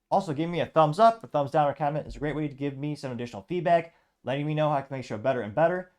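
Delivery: high, loud sign-off pitch to viewers; enthusiastic outro. Acted. Host urging viewers to subscribe and share, enthusiastic. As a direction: hype up the audience for subscribes.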